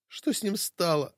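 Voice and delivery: hoarse voice through a covering hand; hoarse, hand over mouth. Acted. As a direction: croak it behind your palm. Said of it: "севшим голосом, прикрывая рот ладонью".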